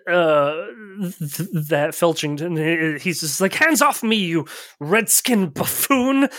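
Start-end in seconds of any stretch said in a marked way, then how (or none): 3.5-6.3 sputtering foiled villain. Acted. Jockey shaken, threatens revenge.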